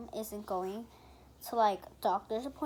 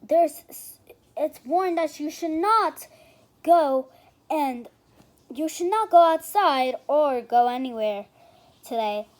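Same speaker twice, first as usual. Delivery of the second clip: loud news-style announcement; 12 dB louder, slower than usual. Acted. Girl reading the weather warning to viewers, announcer voice. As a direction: announce the warning loudly, like a TV report.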